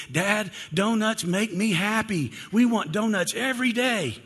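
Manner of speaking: lightly voiced eager child; begging child. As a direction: play a small kid wheedling dad for donuts. Kids begging dad for donuts.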